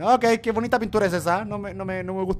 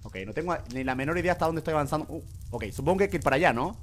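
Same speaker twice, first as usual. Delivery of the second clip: ordinary talk, nothing marked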